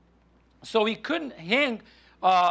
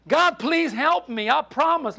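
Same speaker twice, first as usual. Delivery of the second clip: desperate pleading; 6 semitones higher, 3 dB louder than usual. Acted. John begs God to save him.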